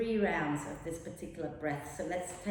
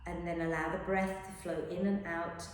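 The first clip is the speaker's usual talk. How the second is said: guided meditation; 3 semitones lower, 8 dB quieter than usual. Acted. Teacher guiding students into rest, soft meditation voice. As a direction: ease the class into rest, softly.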